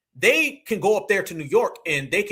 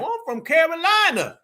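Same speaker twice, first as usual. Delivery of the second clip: high, louder mocking imitation; exaggerated put-on voice, pitched way up; mock Tether claim; 11 semitones higher, 4 dB louder than usual. Host mimics Tethers claiming to be from Carolina, high mocking imitation. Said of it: "They always say Carolina".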